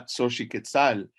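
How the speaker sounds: ordinary delivery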